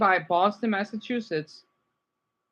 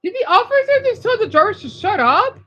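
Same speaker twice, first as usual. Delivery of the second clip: shocked; 13 semitones higher, 7 dB louder than usual. Real, not acted. Shocked reaction to clip.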